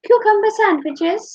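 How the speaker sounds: high, lilting posh cucumber sandwiches; mock-posh hostess; posh lady voice. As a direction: put on a grand hostess voice. Posh tea role-play.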